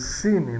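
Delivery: "cine" ending like "cini"; slow "cine" with the final E turned to I; Portuguese final I. Shown as a demonstration. Teacher showing how Portuguese speakers say it, final I. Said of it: "a gente às vezes".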